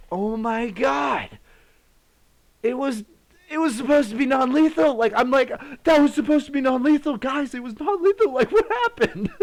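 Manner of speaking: very high, loud, laughing panic; retold freak-out with laughter; panicked disbelief. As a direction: squeal it in frantic disbelief. Panicked over lethal grenade.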